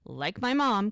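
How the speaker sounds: normal talking voice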